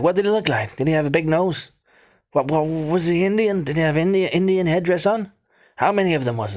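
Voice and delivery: high, loud, rapid-fire questioning; frantic questions. Retold or imitated. Boy frantically questioning his brother, high and loud. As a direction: pelt him with eager questions.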